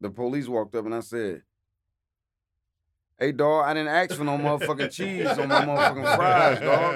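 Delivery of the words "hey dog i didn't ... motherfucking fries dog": laughing. Real, not acted. Complaining to police, laughing.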